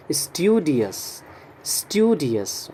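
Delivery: "स्टूडियस" said slowly in a British accent, STYOO-di-us; British "स्टूडियस" with a y glide after the t; British pronunciation. Shown as a demonstration. Teacher modelling the British accent for the class. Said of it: "British pronunciation है इसका".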